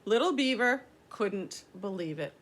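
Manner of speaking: ordinary delivery, nothing marked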